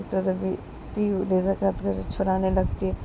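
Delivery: ordinary delivery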